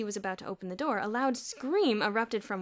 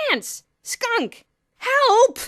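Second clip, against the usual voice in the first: panicked shouts; 16 dB louder, much slower than usual. A narrator's reading. Dozens of boys in a riot.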